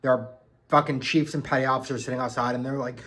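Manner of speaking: usual speaking voice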